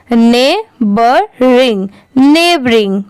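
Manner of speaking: Neighbouring stretched out slowly, syllable by syllable. Shown as a demonstration. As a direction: draw out neighbouring slowly for the class. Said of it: "इसे ring पढ़ेंगे".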